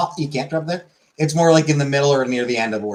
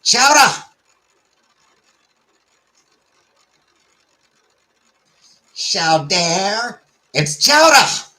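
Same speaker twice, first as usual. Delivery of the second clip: high, loud, exaggerated Boston accent; 9 semitones higher, 5 dB louder, much slower than usual. Shown as a demonstration. Streamer hamming up the word chowder, high loud Boston accent. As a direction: holler it like a Bostonian. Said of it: "the Boston accent".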